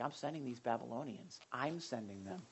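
low, hushed voice of God; restrained divine rebuke, spoken softly; God's reply. Acted. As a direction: answer quietly and low, with pauses. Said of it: "He's like".

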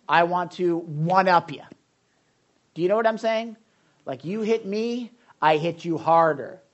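a mildly worked-up, vengeful inner voice; voice of the flesh. Acted. Preacher voicing his flesh to the congregation, mildly heated. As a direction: lightly act out the grudge-holding self. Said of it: "That's what my flesh says".

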